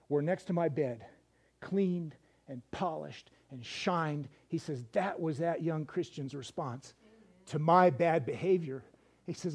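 slow, low and hushed, choked up; real tearful emotion. Real, not acted. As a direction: fight back tears through a moving testimony. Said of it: "I had to get my Kleenex".

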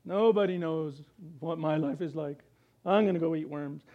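mock self-pity, lightly voiced; martyr whine. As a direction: play the sulking martyr.

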